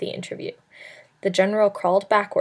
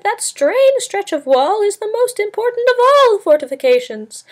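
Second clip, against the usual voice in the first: a scheming soothsayer's high, eerie screech; 14 semitones higher, 4 dB louder than usual. A narrator's reading. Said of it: "the fellow screeched".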